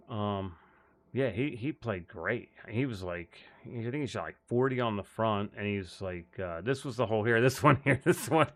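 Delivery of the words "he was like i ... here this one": ordinary delivery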